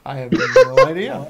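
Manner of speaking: loud laugh through the words. Real, not acted. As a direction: crack up while answering. Laughing at mic mishap.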